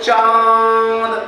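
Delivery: चांद with a long nasalised AA; चांद held long on a steady nasal vowel. Shown as a demonstration. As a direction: stretch चांद through nose and mouth together. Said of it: "हवा नाक और मुंह से".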